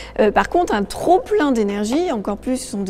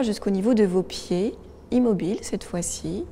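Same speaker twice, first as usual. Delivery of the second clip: soft, calm meditation voice; guided meditation; 5 dB quieter than usual. Acted. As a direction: guide them gently into their body. Leads a relaxation exercise. (voix de méditation guidée)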